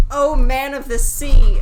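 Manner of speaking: booming, slow plea to the sea; loud call to the sea. Acted. Fisherman calling loudly to the fish of the sea. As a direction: call out loud across the waves. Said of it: "and said".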